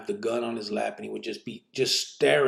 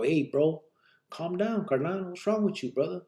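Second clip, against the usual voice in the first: retold plea; 7 semitones higher than usual. Talking a carnal down, retold high-pitched plea. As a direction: plead it higher, without shouting. Retelling his plea to calm down.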